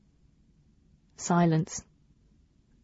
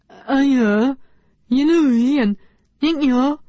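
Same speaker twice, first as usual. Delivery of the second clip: higher, louder flustered alien voice; Nuala the alien girl; 6 semitones higher, 4 dB louder, slower than usual. Retold or imitated. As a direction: stammer like a tongue-tied alien. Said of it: "She turns into Nuala the alien girl".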